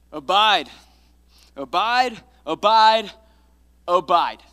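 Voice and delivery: loud, ringing proclamation; proclaiming. Acted. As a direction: cry the word out to the church. Preacher proclaims key word.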